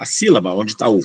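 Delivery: normal talking voice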